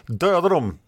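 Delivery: high, falling kill order; battle cry. A narrator's reading. Knight orders his men to attack, high battle cry. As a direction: call the order out to the troops. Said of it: "ropade herr Negel".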